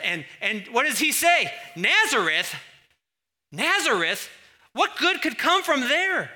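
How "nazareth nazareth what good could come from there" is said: loud, incredulous, climbing much higher at the end; hammed-up dismissive outburst; scornful disbelief. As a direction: scoff at the very idea of the place. Acts out Nathanael's scornful reply.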